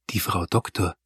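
soft hushed aside to him; hushed voice, caller is the doctor; whispered. A narrator's reading. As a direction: tell him quietly who's calling. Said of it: "flüsterte sie".

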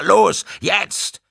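high, loud urgent command; shouted call. A narrator's reading. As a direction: shout it out to the team. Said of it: "rief durch das offene Fenster". (laut gerufenes, drängendes Kommando)

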